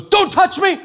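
high, loud frantic begging; panicked plea. Acted. Psychic recoiling from a praying preacher, panicked. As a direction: shriek it as if terrified of being touched.

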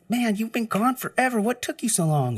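high mock exasperated question; mock annoyed coworker scolding; annoyed complaint.

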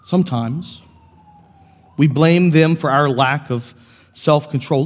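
ordinary talk

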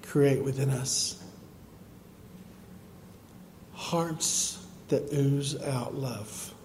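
fervent closing prayer. Real, not acted. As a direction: pray aloud for the whole church.

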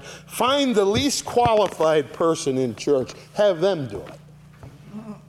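Paul's sarcastic jab at the church. Acted. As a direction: drip sarcasm as you stretch it out. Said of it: "That's a very sarcastic comment".

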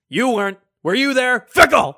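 loud, high taunting shout; escalating mock-indignant yell; mock heckling. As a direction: shout it like a heckler, building to the last word.

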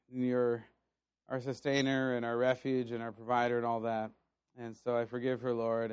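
low, soft retold prayer; subdued prayer. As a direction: pray quietly, the anger all spent. Retold prayer after anger is spent.

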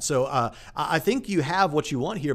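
usual speaking voice

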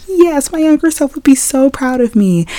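high, mock-enthusiastic gushing; imagined inspired reply. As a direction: gush as if moved by a pep talk. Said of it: "I was gonna be like".

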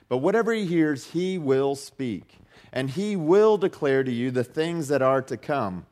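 lifted Bible reading to the congregation; scripture reading. Acted. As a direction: read the verses out with weight. Reads scripture passage aloud.

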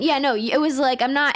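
ordinary delivery

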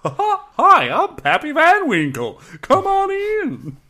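very high, loud cartoon clown; Pappy Van Winkle. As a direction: welcome them as a manic clown, stretching the words. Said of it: "sounds like a fucking fantasy clown".

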